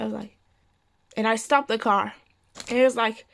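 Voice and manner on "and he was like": usual speaking voice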